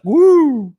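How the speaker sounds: excited whoop. Real, not acted. Sudden whoop mid rant.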